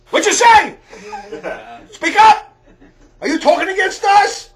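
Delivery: high, loud, laughing yell; yelling from the back room, half laughing; mock shouting. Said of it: "in the back room going".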